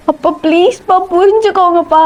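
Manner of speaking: high-pitched begging daughter; pleading. Acted. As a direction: beg your father to understand. Daughter begs her father. (கெஞ்சும் உயர்ந்த குரல்)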